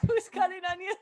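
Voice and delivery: high-pitched, laughing question; joking through laughs, pitched high; laughing joke. Real, not acted. Joking after an emotional moment.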